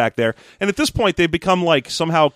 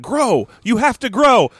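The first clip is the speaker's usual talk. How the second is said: higher-pitched mock order to a plant; urgent command; 9 semitones higher, 3 dB louder, slower than usual. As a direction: act out an urgent, insistent command. Acts out her commanding the orchid.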